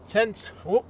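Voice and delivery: ordinary talk, nothing marked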